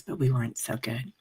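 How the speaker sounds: ordinary talk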